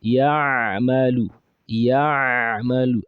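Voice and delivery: Ya'malu stretched, Ain pressed hard from mid-throat; exaggerated throat sound. Shown as a demonstration. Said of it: "I'm here exaggerating it".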